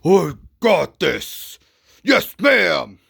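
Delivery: loud, slow, shouted bear voice; gruff bear. Retold or imitated. Crazy bear agreeing to the job, shouted slowly. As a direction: bellow it like a hired brute. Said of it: "he was like".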